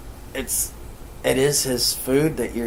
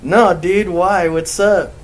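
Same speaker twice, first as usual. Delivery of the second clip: feigned innocence; 6 semitones higher, 6 dB louder, slower than usual. Retold or imitated. Retells own answer to nephew.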